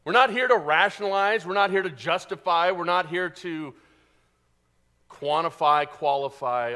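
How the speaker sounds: a loud sermon climb; preaching. Acted. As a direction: hammer each "not" from the pulpit. Preaching at full force.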